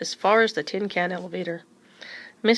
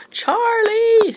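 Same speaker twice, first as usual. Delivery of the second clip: much higher, louder call for her husband; shout; 9 dB louder than usual. A narrator's reading. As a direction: yell his name in panic. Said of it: "shouted Missus Newcomb".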